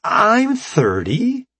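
high, slow, bright chirp; perky young scientist. Acted. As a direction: sweetly introduce yourself. Scientist indignant.

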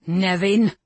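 sharp angry call; snapping. A narrator's reading. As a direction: snap the name out. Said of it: "Cullen snapped".

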